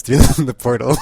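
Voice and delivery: laughing. Real, not acted. Recapper tickled by the portal jump, laughing. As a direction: crack up as you tell it. Cracking up while retelling scene.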